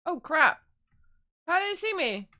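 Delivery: loud, high panicked outburst; panicking gamer. Real, not acted. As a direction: caught out, panic loudly. Spotted by guard mid-sneak.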